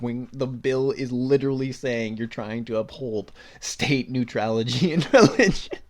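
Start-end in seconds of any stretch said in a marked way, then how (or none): 4.9-5.8 laughing. Real, not acted. Laughing at the bill's title.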